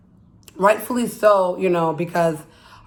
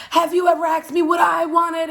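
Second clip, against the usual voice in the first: exploding at him; 9 semitones higher than usual. Retold or imitated. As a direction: blow up after years of holding it in. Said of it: "like, explodes".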